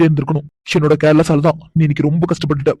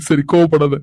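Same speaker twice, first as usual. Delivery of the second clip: usual speaking voice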